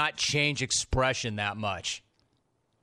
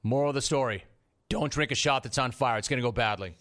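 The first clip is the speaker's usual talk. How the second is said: ordinary talk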